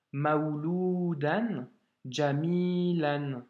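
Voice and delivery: maouloudan jamilan read slowly, syllable by syllable; slow demo reading. Shown as a demonstration. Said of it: "un peu plus rapidement". (lu lentement, syllabe par syllabe)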